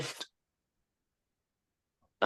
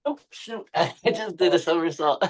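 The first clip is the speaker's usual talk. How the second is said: startled laugh, higher; laughing at your own tumble; laughing surprise; 8 semitones higher than usual. Real, not acted. Tumbles over mid-pose.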